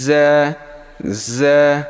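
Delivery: জ্যা with a long buzzing z; buzzy za. Shown as a demonstration. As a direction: buzz the start of জ্যা sharply, then hold it. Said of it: "এটা একটু ঝাঁঝালো টাইপের হবে". (ঝাঁঝালো করে জ্যা)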